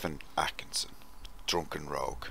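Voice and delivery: hushed whisper of the name. Real, not acted. Host whispers the listener's name and handle. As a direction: breathe the name out, no voice.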